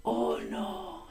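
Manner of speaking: an unvoiced whisper of dread; whispered thought. A narrator's reading. The man whispering to himself in sudden dread. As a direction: breathe it out as a private panic. Man's panicked inner thought.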